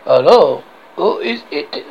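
put-on comic French guard accent; outrageous French accent. French guard answers the castle hail, outrageous French accent. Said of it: "I have this outrageous accent".

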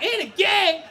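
very high, loud shriek of disbelief; shocked mom. Acted. Mom hearing her son is accused, shocked. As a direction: echo it as an aghast mother.

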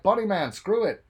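loud, high mock cheer; rally the town like a crowd; hammed-up crowd chant; cheering townsfolk. Townsfolk cheering the Bunny Man.

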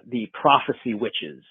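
ordinary talk, nothing marked

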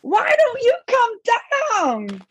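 high, sing-song call; calling out playfully. Retold or imitated. Mark Hamill popping out to call Sophie, high sing-song call. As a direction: call her name brightly from behind a curtain. Hamill calls from behind curtain.

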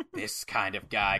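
low, slow, quiet reply; deadpan admission. Retold or imitated. Gene answers Suge's outrage, low deadpan admission. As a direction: own up to it, low and unhurried.

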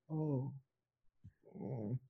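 very soft imitated moaning; faint moans as if through a wall; muffled moans.

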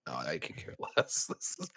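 hushed, unvoiced mutter of indifference; whispered aside. Confessing boredom to co-hosts, whispered aside. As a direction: whisper it under your breath.

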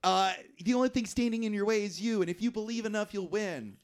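put-on high-pitched indignant kid; mock-earnest pep talk from an angry son; Mike. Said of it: "And Mike's like".

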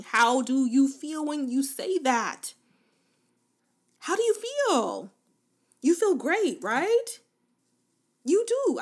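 hyped coach after positive affirmations; excited, upbeat. Real, not acted. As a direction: lift the listener with energy. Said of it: "Look at how my voice even changed".

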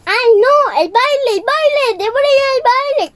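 high, loud, hurried panic; panicked Cinderella. Acted. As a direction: fret you'll miss the ball. Cinderella panics about the ball.

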